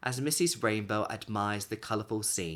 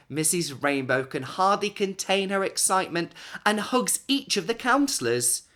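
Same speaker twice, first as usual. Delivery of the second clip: higher and louder, bursting with joy; excited; 8 semitones higher, 5 dB louder than usual. A narrator's reading. Narrator sharing the old lady's delight, excited. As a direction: let the excitement bubble over.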